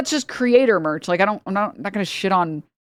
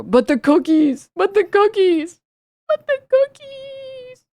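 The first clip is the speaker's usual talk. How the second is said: climbing higher and slower each time, ending in a squeaky mock whine; but the cookies, pitched up further every time; mocking squeal; 13 semitones higher, slower than usual. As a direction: mimic the outraged critics, rising to a silly squeal.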